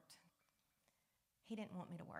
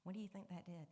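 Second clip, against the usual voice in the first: normal talking voice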